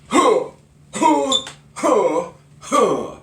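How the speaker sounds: loud terms each with a high karate huh; karate chant. Shown as a demonstration. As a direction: shout each word then grunt like a karate strike. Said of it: "the grunt really helps".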